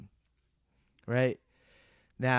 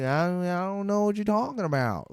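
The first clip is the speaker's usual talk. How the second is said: country bumpkin; 4 semitones higher than usual. Retold or imitated. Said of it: "country bumpkin, mad, fidgety".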